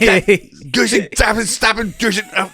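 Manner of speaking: put-on very high, comic Hitler accent; hammed-up, high-pitched German accent. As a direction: squawk it out in a mock Hitler accent. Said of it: "trying to do an accent".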